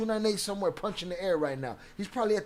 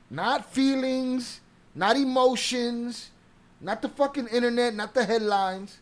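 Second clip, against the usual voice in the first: slow, higher, each "not" hammered; emphatic list; 5 semitones higher, 3 dB louder, slower than usual. Real, not acted. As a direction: spell it out slowly, pitch up. Listing what truth is not.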